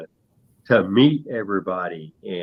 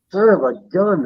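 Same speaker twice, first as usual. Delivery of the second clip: sudden pained outcry, higher; hit hard crying out; 8 semitones higher than usual. Real, not acted. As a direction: yelp it out after a knock. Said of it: "just bumped my elbow".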